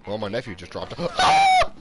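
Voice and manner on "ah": sudden loud, high cry; high startled yelp. Real, not acted. Gamer startled mid-game, gasp and high yelp.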